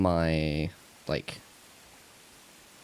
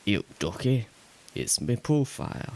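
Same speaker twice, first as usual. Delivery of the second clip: put-on light, playful Yorkshire accent; 5 semitones higher than usual. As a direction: slip into a jokey Yorkshire accent. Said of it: "Why am I doing a Yorkshire accent?".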